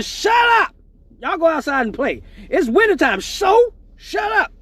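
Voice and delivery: high, loud mock yell; hammed-up parent yell; yelling at kids. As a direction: shout the kids outside.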